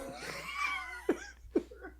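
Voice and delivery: high squealing laugh; helpless giggling fit; burst of laughter. Real, not acted. Laughing at her own hiccups.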